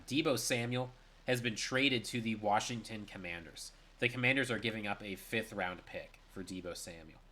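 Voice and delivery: usual speaking voice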